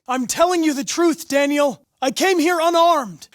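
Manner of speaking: loud plea to trust him; urgent plea. Acted. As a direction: call out to convince him. Pleading with a hostage taker.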